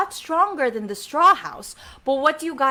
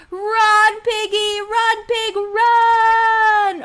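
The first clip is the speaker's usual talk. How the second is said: high drawn-out warning call; shouting; 8 semitones higher, 4 dB louder, much slower than usual. Pretend. Storyteller calls to the fleeing piggy, high urgent shout. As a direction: call it out high, holding the last word. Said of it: "Can you shout out to the pig".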